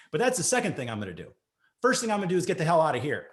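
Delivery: fast, defiant rush of words; fast retort. Retold or imitated. Patient rushing his exit line at the doctor. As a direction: rattle it off fast, done with him.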